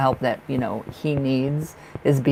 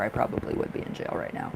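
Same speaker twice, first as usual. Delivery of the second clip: muttered aside; 6 semitones lower, 8 dB quieter than usual. Real, not acted.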